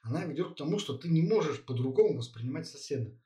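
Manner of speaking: normal talking voice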